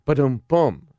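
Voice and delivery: ba-dum-bum comedy drum hit, voiced; spoken rimshot. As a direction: mimic a drum sting after a punchline. Rimshot after telling a joke.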